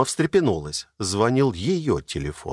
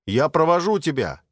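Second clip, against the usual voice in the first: raised voice across the flat; calling out; 8 semitones higher than usual. A narrator's reading. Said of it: "также громко ответил". (громко, через всю квартиру)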